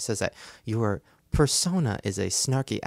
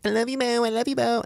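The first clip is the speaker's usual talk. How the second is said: gushing fans; 13 semitones higher, 5 dB louder than usual. Retold or imitated. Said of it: "the fans were, like, being like".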